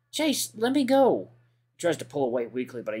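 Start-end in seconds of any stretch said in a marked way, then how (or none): 0.1-1.3 slow, higher, pleading; frightened plea. Acted. TJ begs Chase to release his arm, slow and scared. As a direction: beg him to let go, scared.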